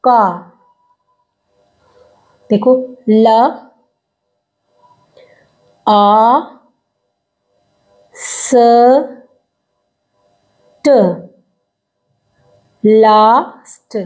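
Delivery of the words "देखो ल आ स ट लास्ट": ल, आ, स, ट, then a very slow stretched लास्ट; slow phonics of लास्ट; sounding out लास्ट. Shown as a demonstration. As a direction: pull लास्ट apart sound by sound. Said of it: "ला की sound आ रही है".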